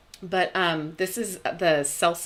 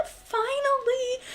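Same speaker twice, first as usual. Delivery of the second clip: teary laugh; slower than usual. Real, not acted. Announcing her big news.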